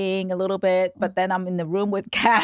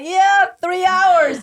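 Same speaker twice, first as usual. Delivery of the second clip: eager yes; 8 semitones higher, 5 dB louder, much slower than usual. Retold or imitated. Friends mimicked agreeing eagerly.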